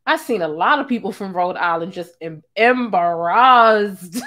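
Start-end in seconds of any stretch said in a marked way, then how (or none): 2.6-4.2 drawn-out shout. Real, not acted. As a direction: stretch it into a yell. Exaggerating people's reaction.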